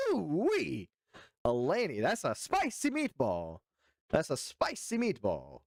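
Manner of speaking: high, swooping, drawn-out exclamation; exaggerated mock-impressed swoops in pitch; hammed-up catchphrase. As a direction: stretch it out like a cartoon catchphrase. Reacting with a stock catchphrase.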